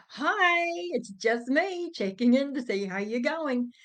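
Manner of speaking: high, loud sing-out greeting that settles; pretend cheerful energy. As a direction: put on breezy cheer for the phone. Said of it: "pretend energy I was trying to embody".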